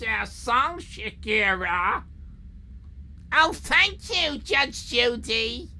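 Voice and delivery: put-on slow, drawled American accent, lightly done; mock Tennessee drawl. Judge Judy character answers, Tennessee.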